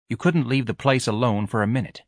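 usual speaking voice

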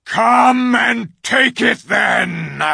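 slow, loud bull bellow. Acted. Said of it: "in a great bellowing voice".

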